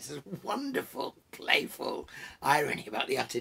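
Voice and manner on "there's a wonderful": ordinary delivery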